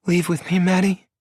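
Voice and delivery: put-on high young woman, choking on tears; choked out. Star begging Maddie to leave, choking on tears. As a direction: plead through a tight throat. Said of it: "she choked out".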